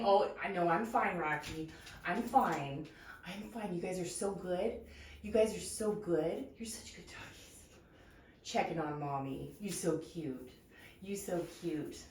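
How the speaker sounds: soft, hushed cooing to dogs; talking to a dog. Real, not acted. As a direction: gently reassure the pups. Soothing dogs softly.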